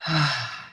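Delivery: long audible exhale on an "ah"; demonstrated sigh. Shown as a demonstration. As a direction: let out a good releasing sigh. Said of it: "or a really good sigh".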